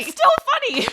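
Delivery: high and laughing. Real, not acted. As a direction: giggle out the words. Laughing recap.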